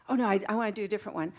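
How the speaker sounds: usual speaking voice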